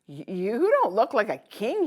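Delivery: loud, surprised stammer; gasped. Acted. Girl realizing the man is the king, gasping. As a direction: catch your breath in surprise. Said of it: "she gasped".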